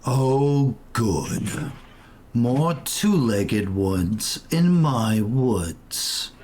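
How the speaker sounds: deep, slow, rumbling tree voice; low and drawn-out ancient tree; talking tree. Acted. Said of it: "You hear a deep voice".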